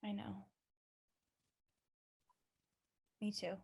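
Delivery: very soft and low; hushed, low admission; quiet agreement. Acted. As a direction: murmur it gently, just between two people. Softly agreeing in a serious scene.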